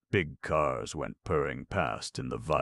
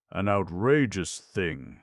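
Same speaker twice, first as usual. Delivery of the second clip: loud, slow, outraged uncle; violent outburst; 4 semitones higher, 5 dB louder, slower than usual. A narrator's reading. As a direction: spit out each word in outrage. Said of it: "said Uncle Benjamin violently".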